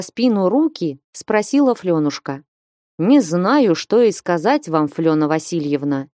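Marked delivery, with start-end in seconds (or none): none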